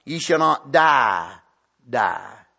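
"Ye shall not die, die" with the second "die" dropped low; stress on the doubled die. Shown as a demonstration. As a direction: echo "die" a second time, falling and low.

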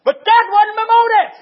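emphatic protest. Real, not acted. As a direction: burst out loud in self-defence. Protests his honesty while preaching.

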